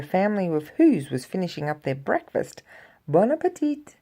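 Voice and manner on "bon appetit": high, bright greeting; cheerful. Acted. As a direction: cheerfully greet the diners as you pass. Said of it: "she said cheerfully".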